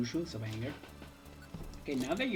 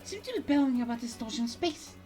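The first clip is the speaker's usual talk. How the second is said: higher-pitched, shocked hero; bewildered game character; 10 semitones higher than usual. Acted. Hero checking on Jimmy after the attack, bewildered.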